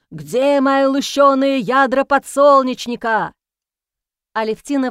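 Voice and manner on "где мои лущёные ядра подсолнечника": loud, slow booming demand; thunderous bellow. A narrator's reading. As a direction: bellow it down from the roof. Goat keeps bellowing.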